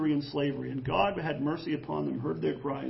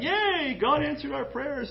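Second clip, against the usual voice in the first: mock cheer; 7 semitones higher, slower than usual. People cheering at quail.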